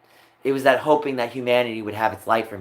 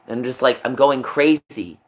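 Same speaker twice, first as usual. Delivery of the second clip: ordinary talk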